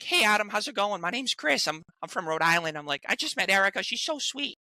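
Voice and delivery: high Adam Goldberg voice greeting Adam himself; high Goldberg voice greeting the real Adam; Adam Goldberg impression. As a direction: pitch up into the Goldberg voice. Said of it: "He does a great impression of you".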